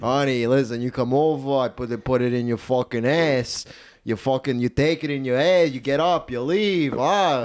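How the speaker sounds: crude Italian guy coming on to honey; Italian predator. Retold or imitated. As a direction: imitate a pushy Italian-American sleaze. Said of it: "That's kinda how he talks".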